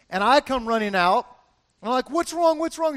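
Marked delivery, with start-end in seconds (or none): 2.1-2.9 high-pitched urgent questioning; imitating a panicked rush to help; alarmed. Husband running out to his hurt wife, alarmed.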